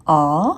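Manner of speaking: आ as an open "aw" vowel; short O sound. Shown as a demonstration. Teacher demonstrates the short sound of O, आ said alone. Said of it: "ओ का short sound होता है".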